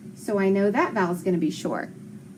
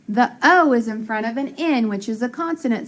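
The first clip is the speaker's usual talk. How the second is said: ordinary talk, nothing marked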